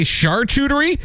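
'charcuterie' high, as SHAR-koo-ter-ee; sh pronunciation. Shown as a demonstration. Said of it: "Because if you say charcuterie".

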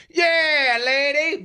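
high, yelled catcall; mock catcaller hollering. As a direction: holler at her from a passing truck. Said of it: "would it be okay if he was just like".

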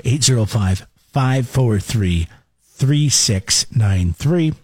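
very slow digit by digit; slow, spaced-out number. Real, not acted. Host repeating number for listeners, slow and spaced. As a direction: read the number out slowly in groups. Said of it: "give it to you one more time".

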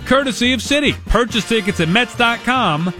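upbeat fast promo read; radio contest promo. Acted. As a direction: pitch the ticket giveaway over music.

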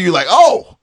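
ordinary delivery

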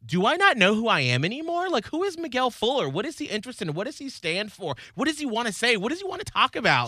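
high-pitched retold identity crisis; freaking out. Host retelling his weekend self-doubt, freaking out. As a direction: spiral through anxious questions in a high voice. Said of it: "whole major freak out".

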